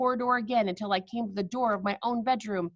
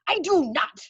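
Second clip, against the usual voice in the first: loud, higher flat refusal; shouted; 7 semitones higher, 5 dB louder than usual. Acted. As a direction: bark the refusal. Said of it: "shouted the Grand High Witch".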